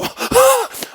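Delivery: sudden high laugh; laughing hard, high and loud; high burst of laughter. Real, not acted. Laughing at own fire story.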